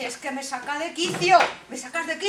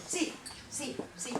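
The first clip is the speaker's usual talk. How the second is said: whispered gloat; 15 dB quieter than usual. Acted. Queen gloating.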